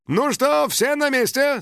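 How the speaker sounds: hearty bus driver question; driver calling out. A narrator's reading. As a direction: check on the whole bus. Driver calls out to passengers.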